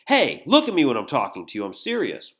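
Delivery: loud demand with a touch of anger; a little angry. Acted. Danny snapping at Jill to look at him, a little angry. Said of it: "he just got a little angry".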